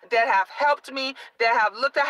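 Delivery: ordinary talk, nothing marked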